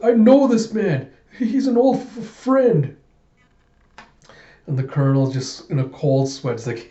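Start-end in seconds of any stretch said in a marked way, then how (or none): 0.0-3.0 high-pitched nervous stammer; imitating a timid man begging; panicked plea. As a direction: plead in a frightened high voice. Yorg pleading for the colonel.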